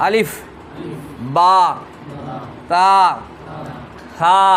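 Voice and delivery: Arabic letter names called out one by one, slowly, with pauses between; teacher chanting Arabic letters for students to echo; letter recitation drill. Shown as a demonstration. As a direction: recite the alphabet slowly for the class to repeat. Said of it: "বলেন আলিফ".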